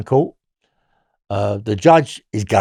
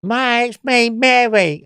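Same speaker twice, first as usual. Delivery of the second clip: a retold high-pitched visitor at night; Mary at the door; 11 semitones higher, slower than usual. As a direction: voice raised high for the inmate Mary. Inmate Mary knocking at the cell door.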